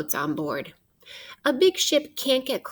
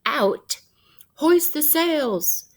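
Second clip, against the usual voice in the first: stretched, higher pirate-captain call; 6 semitones higher, slower than usual. Retold or imitated. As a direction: call it across the deck like a captain. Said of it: "When the captain calls out".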